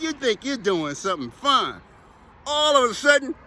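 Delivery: usual speaking voice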